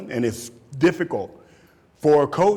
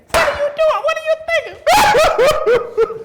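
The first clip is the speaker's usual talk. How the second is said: very high, loud laughing yell; dad yelling; 8 dB louder than usual. Retold or imitated. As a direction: blow up at the second kid. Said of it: "I say the same thing".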